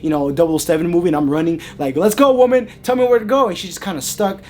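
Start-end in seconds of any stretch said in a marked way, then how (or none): 2.0-3.5 high, loud panicked urging; urgent command. Retold or imitated. Fleeing man urges the frozen lady on, loud and urgent. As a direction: push her to move right now.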